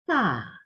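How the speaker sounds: "Ta" sinking as if let down; disappointed falling tone. Shown as a demonstration. Teacher likens the fourth tone to disappointment, falling tone. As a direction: let "Ta" fall in mild disappointment. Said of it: "una suerte de desilusión".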